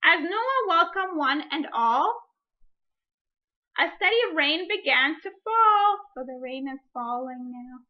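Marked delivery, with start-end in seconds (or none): 0.0-6.0 put-on reading a picture book aloud to children; story reading. Teacher reading a picture book to her class, storytime voice.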